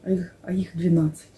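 unvoiced hushed whisper. Real, not acted. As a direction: whisper the number. Whispered figure.

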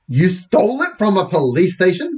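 shocked mum. Acted.

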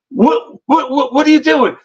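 stammered retold protest; retold alarmed protest. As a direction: imitate a startled, indignant question. Girl protests at the hotel.

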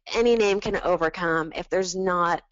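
ordinary delivery

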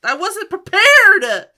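loud, high, laughing shouts; overwhelmed excitement. Real, not acted. Overwhelmed by sudden effect.

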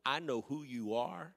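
rising to a preacher's shout, slowing down; preaching build. Acted. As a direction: build from firm to shouting, slowly. Preaching God's promise.

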